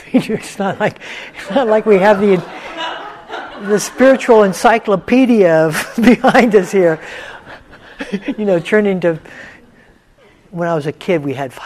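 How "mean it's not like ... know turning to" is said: raised, louder voice with laughter; laughing. Real, not acted. Teacher joking with students about spiritual knowledge, laughing. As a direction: talk loudly through a laugh.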